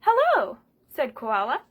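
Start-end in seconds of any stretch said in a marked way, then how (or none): 0.0-0.6 high, loud falling hello; high sing-song hello. Acted. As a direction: sing it back brightly. Koala answers back.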